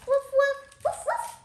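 high yappy woofs; puppy barks. Pretend. Child barking as a pretend puppy dog. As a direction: bark like a playful puppy. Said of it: "I'm the evil puppy dog".

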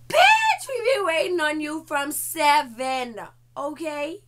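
sudden high, loud outburst; indignant yell. Retold or imitated. Scolding the late guy, a sudden high yell. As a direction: snap at him for being late.

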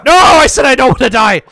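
high, loud scream of not wanting to die; panicked yell. Real, not acted. Player yelling at the game while being hunted, loud panic. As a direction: shout it out in real panic.

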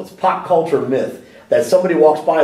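ordinary talk, nothing marked

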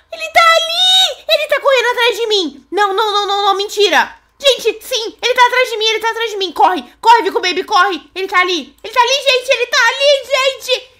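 panicked high shouting while fleeing; frantic screams, running away; being chased. Real, not acted.

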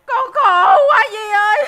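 slow, loud, tearful wail; crying lament. Acted. Distraught wife cries to café seller, drawn-out wail. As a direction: sob it out to auntie. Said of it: "mày đừng có khóc nữa".

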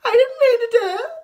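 put-on high, loud crying child's wail; whiny fake innocence. Kid caught stirring trouble pleads innocence, high loud whine. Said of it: "you're like".

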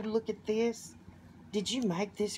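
ordinary delivery

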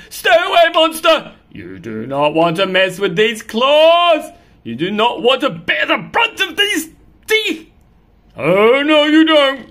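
loud, high warning; threatening cat. Acted. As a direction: bravado against the monster.

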